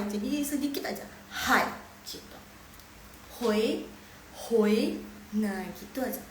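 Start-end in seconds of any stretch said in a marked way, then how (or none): 3.4-5.0 HOI rising like a question; second tone. Shown as a demonstration. Teacher modelling hui for students, rising second tone. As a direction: lift HOI upward on the second tone. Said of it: "Hoi nada kedua".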